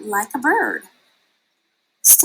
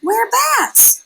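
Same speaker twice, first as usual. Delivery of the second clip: much higher, loud; bright exclaim; 14 semitones higher, 6 dB louder than usual. A narrator's reading. Mother bat reminding Stella Luna what they are, high proud exclaim. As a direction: proclaim it proudly.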